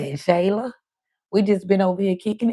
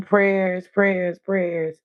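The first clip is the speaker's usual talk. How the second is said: usual speaking voice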